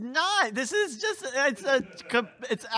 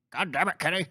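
Cartman impression; faster than usual. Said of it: "A classic Cartman saying".

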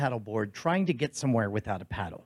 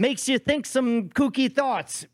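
emphatic declaration; 9 semitones higher than usual. Acted. Co-host explaining his friend's heat madness, high emphatic declaration. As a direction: declare it like a case for the defence. Mock-earnest defence on friend's behalf.

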